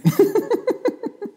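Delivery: a loud high burst of laughter. Real, not acted. A monk laughing at his own warning, a loud high burst of laughter.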